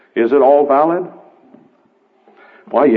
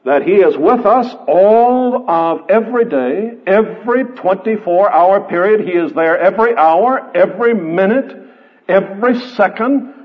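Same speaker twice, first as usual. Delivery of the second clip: preaching; 7 semitones higher than usual. Acted. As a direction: proclaim it to the congregation. Preaching at full force, raised voice.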